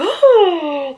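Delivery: shocked gasp. Retold or imitated. Whole party reacts in shock.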